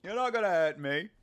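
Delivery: lightly put-on South African accent; scoffing champ, South African accent. Streamer as champ dismissing the challenger, South African accent. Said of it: "I like that South African accent".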